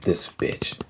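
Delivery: soft, unvoiced mutter; under her breath. Acting nonchalant but thinking it, a soft unvoiced mutter. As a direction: mutter it to yourself. Inner thought while acting calm.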